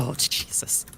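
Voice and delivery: breathy whispered groan of embarrassment; under your breath, cringing; whispered dismay. Real, not acted. Groans at ratio talk.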